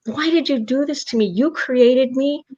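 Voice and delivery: high-pitched anguished cry, last words slowed right down; retold yell at God. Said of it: "yelling at God".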